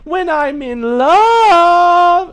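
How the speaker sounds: very high, loud, slow declaration; melodramatic cleric. Acted. Cleric stands up to declare love, melodramatic wail. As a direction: wail it, stretching the last word long.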